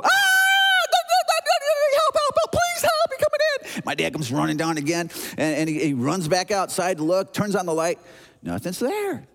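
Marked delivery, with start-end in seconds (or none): 0.0-3.8 put-on very high, loud child's shriek for help; terrified screaming. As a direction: act a panicked boy screaming for rescue. Boy screams for dad.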